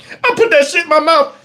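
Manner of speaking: ordinary delivery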